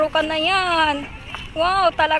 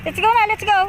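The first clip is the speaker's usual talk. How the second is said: higher-pitched, eager cheering-on; urging a dog on; 5 semitones higher, faster than usual. Real, not acted. Owner urges Kari to get going, eager high calls. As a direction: coax the dog along in a raised pitch.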